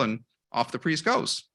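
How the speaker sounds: ordinary talk, nothing marked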